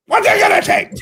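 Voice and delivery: very high strained comic scream; mock desperate shout. Refused customer insisting at Burger King, mock desperate shout.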